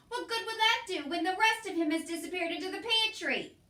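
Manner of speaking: high, loud scolding shout; angry shout. A narrator's reading. Cook shouts angrily at Mig about the mouse. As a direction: berate her at full volume. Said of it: "shouted Cook".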